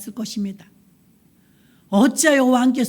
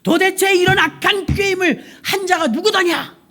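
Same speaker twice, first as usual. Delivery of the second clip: furious king shouting down; angry king shout; 7 semitones higher than usual. Acted. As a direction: bellow the question at the court. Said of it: "왕이 막 화가 났어요".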